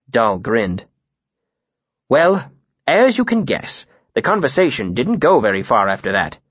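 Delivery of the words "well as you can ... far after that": ordinary delivery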